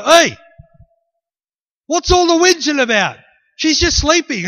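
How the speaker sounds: high, loud call to a whole room. Acted. Jesus to the wailing mourners, loud challenge. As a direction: burst in and call out over the mourners. Voicing Jesus arriving at the house.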